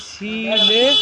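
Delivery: সিলে said loud, syllable by syllable; drawn-out syllables. Shown as a demonstration. Teacher writing out the pronunciation, সিলে loud and drawn out. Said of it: "আমি উচ্চারণটা লিখে দিচ্ছি".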